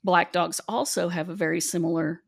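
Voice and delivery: normal talking voice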